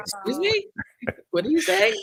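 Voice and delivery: high, incredulous excuse me; indignant disbelief. Retold or imitated. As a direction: act offended and surprised. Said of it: "I said".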